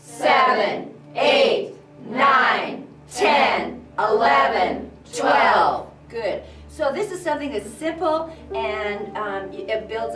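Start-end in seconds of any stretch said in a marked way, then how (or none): 0.0-5.9 loud, articulated counting on one breath. Shown as a demonstration. Coach leads the class, counting loudly to twelve. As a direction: project the numbers to the back wall. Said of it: "let's go to twelve one more time".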